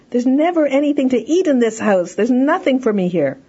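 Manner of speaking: put-on high, loud whiny child; complaining child. Shown as a demonstration. Hungry child complaining to the parent. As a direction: complain like a sulky kid. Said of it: "if the child said".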